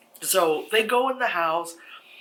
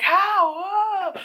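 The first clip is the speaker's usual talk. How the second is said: very high, loud mock wail; freaking out; 6 dB louder than usual. Girls find the door boarded up, freaking out. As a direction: cry out in hammed-up panic. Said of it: "the girls are freaking out".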